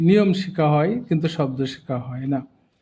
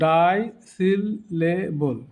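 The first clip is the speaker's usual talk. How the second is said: ডাইসিলেবল very slowly in four beats; broken into syllables; much slower than usual. Shown as a demonstration. Teacher repeating for learners, ডাইসিলেবল syllable by syllable. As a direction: pause after each syllable of ডাইসিলেবল. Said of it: "ভেঙে ভেঙে দেখানো হয়েছে".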